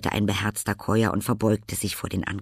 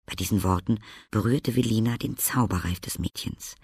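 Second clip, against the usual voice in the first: plain reading voice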